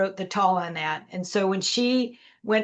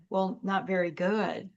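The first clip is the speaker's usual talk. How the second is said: sadly; 7 dB quieter than usual. Retold or imitated. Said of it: "And she sadly said".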